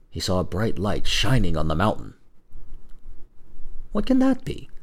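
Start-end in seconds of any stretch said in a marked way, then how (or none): 3.9-4.7 high-pitched puzzled question; wondering young man. Acted. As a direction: ask in wonder, voice lifted. Brother wonders at the light.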